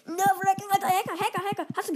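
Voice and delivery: high, loud cry of Hacker; outraged yelling. Real, not acted. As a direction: shout it at the screen. Outraged call during fight.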